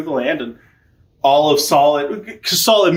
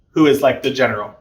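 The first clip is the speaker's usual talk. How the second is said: ordinary talk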